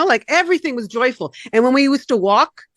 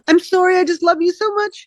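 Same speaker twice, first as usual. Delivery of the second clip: higher, tearful sorry to her daughter; teary apology; 8 semitones higher than usual. Retold or imitated. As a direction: apologize through a hint of tears. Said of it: "are you gonna cry again".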